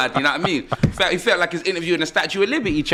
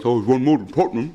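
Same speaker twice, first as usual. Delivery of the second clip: mocking Ty's diss; 7 semitones lower than usual. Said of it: "his classic, uh, patented Tottenham".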